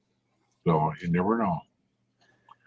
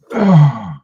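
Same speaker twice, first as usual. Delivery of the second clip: sigh; 4 semitones higher, 7 dB louder than usual. Real, not acted. Sigh after story.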